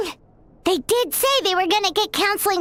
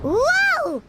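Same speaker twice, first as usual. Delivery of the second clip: surprised whoa; 9 semitones higher than usual. Acted. Reacting to something startling.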